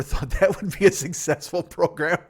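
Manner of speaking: loud, laughing through the words. Real, not acted. Host amused by the talk-show programming, laughing. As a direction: break up laughing as you speak. Laughing at his own point.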